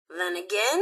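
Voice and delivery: ordinary talk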